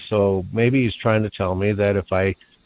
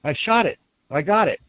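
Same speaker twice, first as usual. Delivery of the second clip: pitched-up retelling of a triumphant shout; retold scream; 6 semitones higher than usual. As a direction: imitate an excited cry at normal volume. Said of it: "screamed out".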